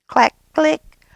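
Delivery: whispered high clicks; keyboard clicks. A narrator's reading. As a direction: tap out the keys with breath. Keyboard sound effect.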